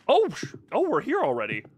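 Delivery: ordinary talk, nothing marked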